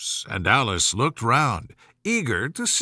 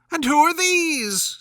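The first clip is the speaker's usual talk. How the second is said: very high, sharp demand; imperious Queen; 13 semitones higher than usual. Acted. Queen asking about the gardeners, imperious. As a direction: point and demand an answer.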